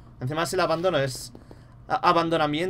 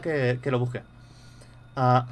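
ordinary delivery

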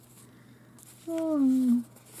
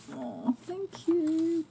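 delighted thanks; 7 semitones higher, much slower than usual. Real, not acted. Thanking gift sender.

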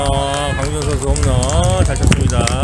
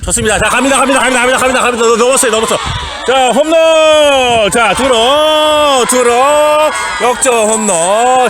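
home run call; 8 semitones higher, faster than usual. Real, not acted. Shouting a go-ahead home run.

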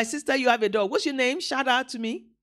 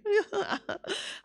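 light, higher chuckle to oneself; acted chuckle; 8 semitones higher, 7 dB quieter than usual. Preacher imitates someone tickled by a memory, light chuckle. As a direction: chuckle as if a memory just came back. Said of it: "we just started smiling".

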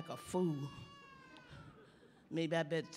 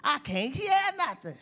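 loud, higher panicked call; calling for help; 7 semitones higher, 6 dB louder than usual. Retold or imitated. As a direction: shout as if deaf. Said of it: "I said".

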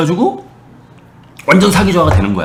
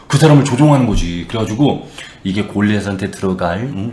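normal talking voice

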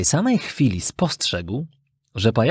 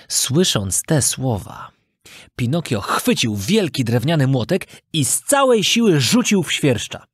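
usual speaking voice

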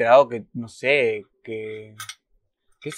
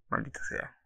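a whispered, voiceless curse; 12 dB quieter than usual. Real, not acted. Viewer cursing the paywall under the breath, whispered. Scene cut, muttered curse.

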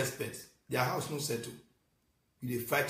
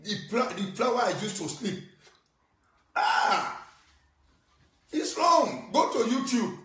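agitated rant; 5 semitones higher than usual. Real, not acted. Upset rant about an insulted wife.

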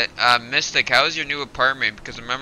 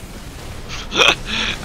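high breathy startled laugh; 11 semitones higher than usual. Real, not acted. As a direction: burst out laughing in shock. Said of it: "I fucking scared".

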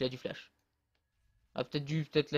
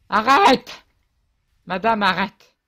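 loud, higher yelling stop; frustrated shout; 5 semitones higher, 11 dB louder, slower than usual. Real, not acted. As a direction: yell at her to quit it. Crie sur une coéquipière. (crie arrête, exaspéré)

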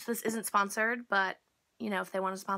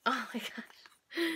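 awed whisper; 4 dB quieter, slower than usual. Real, not acted. Delighted by the nesting dolls.

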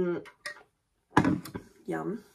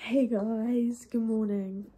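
ordinary talk

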